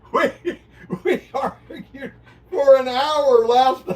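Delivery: words pushed out high and loud through laughter; loud, high laughing speech. Real, not acted. Laughing over windscreen argument.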